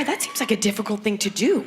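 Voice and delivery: ordinary talk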